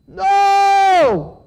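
high, loud shouted reply. A narrator's reading. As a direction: shout it back over the water. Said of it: "they shouted over the water".

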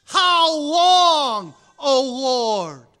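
loud, anguished call to God; loud cry. Acted. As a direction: cry it out to heaven.

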